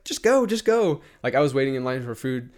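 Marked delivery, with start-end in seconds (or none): 0.0-1.0 higher, louder egging-on; imitated insistent push, pitched well up; retold urging. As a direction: urge a nervous friend forward, retold. Retold urging of starstruck friend.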